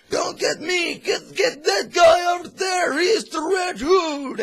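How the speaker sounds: high, loud panicked cry; pleading for life. Retold or imitated. A henchman begging the guards, panicked high shout. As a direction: beg for your life, shouting and near tears. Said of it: "and one of them pleads for their lives, like".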